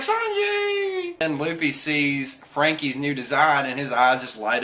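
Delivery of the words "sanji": very high drawn-out name call; hammed-up squeal of alarm; mock alarmed cry. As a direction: imitate crewmates crying out for a friend. Crew cries out in alarm.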